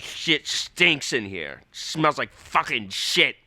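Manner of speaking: put-on slow, disgusted old-man grumble; a light impression of a grouchy old man; grumpy old Voss. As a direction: drag it out like a cranky old comic. Voss complaining about the smell.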